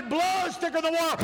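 high, forceful preacher's cry; shouted saying. Retold or imitated. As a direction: shout it out high like a triumphant proof. Dad's saying cried out in preaching.

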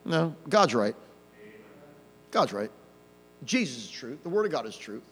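low, soft, settled statement; calm, unraised voice. Shown as a demonstration. Preacher answering the blusterer with God's truth, calm and quiet. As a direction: drop right down, sure of the truth. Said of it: "you don't have to raise your voice".

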